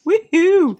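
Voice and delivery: high whooping cheer; cheerful whoop. Real, not acted. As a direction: celebrate with a little whoop.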